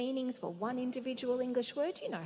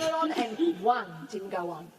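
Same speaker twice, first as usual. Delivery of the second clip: ordinary delivery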